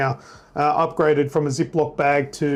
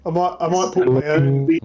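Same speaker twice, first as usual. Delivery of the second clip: usual speaking voice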